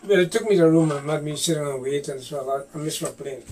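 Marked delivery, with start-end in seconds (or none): none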